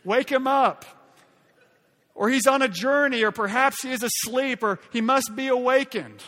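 loud, high sarcastic taunts; mocking. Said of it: "He's mocking them".

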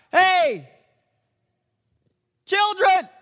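loud high call; shouted across the water. Shown as a demonstration. Preacher shouting to the boat from the shore. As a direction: yell it a hundred yards across the water. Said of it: "It's probably like".

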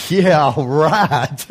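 sarcastic laugh over the words. Real, not acted. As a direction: chuckle through it, mocking the idea. Ironic laugh at no traditions.

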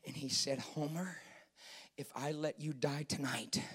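low, very soft deathbed story; hushed preaching. Real, not acted. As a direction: tell it gravely, almost whispering at times.

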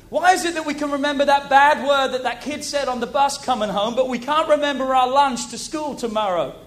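loud, driving preacher's voice; full-force preaching. Real, not acted. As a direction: preach it to the congregation. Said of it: "I'm preaching now".

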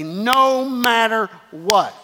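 NO MATTER drawn out long, then WHAT; slow, declarative "No matter what"; emphatic. Shown as a demonstration. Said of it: "and just emphatically".

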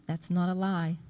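ordinary talk, nothing marked

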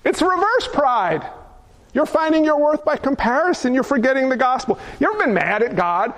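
ordinary talk

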